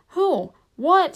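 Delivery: high, startled shout. A narrator's reading. Frodo rushing round the tree to his friend, startled shout. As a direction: shout the questions in alarm. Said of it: "shouted Frodo".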